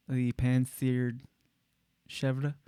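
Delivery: "pan-seared chevre" slowly, with a sharp sibilant S. Retold or imitated. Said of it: "talk like that with the S".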